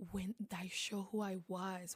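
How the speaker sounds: ordinary delivery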